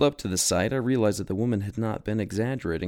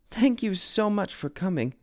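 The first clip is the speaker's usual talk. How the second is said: higher, breathless thanks; relieved homeowner thanking the detective; gushing; 11 semitones higher than usual. A narrator's reading. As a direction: gush with relief. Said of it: "she gushed".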